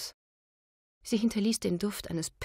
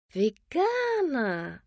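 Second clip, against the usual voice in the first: "Veganer" stretched very slow and high; drawn out; 7 semitones higher, 4 dB louder, much slower than usual. A narrator's reading. Landlady repeats "Veganer" mockingly, drawn out high. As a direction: drawl out "Veganer" with disdain. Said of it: "wiederholte Alexandra Müller-Mertens gedehnt".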